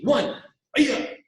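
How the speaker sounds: loud barked count and yells; counting with kiai shouts. Shown as a demonstration. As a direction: shout each count with power. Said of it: "punches on my count".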